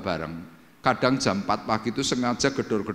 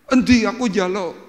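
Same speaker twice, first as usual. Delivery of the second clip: high, loud acted demand; mimicked eager request; imitating eager friend; 10 semitones higher, 7 dB louder than usual. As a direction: beg for it at once, voice jumping up. Acts friend eagerly asking.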